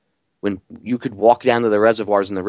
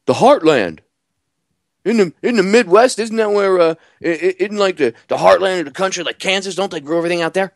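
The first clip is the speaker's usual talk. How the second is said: clueless questioner; 7 semitones higher than usual. Retold or imitated. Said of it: "they're like".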